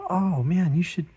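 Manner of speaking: high, loud eager coworker pitch. Retold or imitated. Coworker begging the artist to draw at the staff party, gushing. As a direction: gush like a coworker selling the idea.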